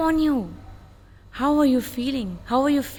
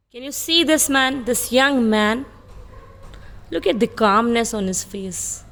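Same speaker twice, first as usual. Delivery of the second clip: usual speaking voice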